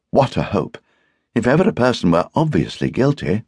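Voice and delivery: mildly scornful. A narrator's reading. Wife judges the accused woman guilty, sniffily. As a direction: dismiss it with a touch of scorn. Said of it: "said Barbara with a slight sniff".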